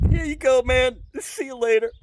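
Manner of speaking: squeaky nervous handoff; awkward high quick goodbye. Retold or imitated. To her fiancé at the door, high nervous goodbye. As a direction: get out fast with a nervous chirp.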